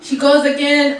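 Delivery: ordinary talk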